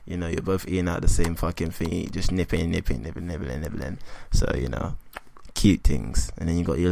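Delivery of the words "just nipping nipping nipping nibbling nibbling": fading to a murmur. Shown as a demonstration. Describing cute shared fries.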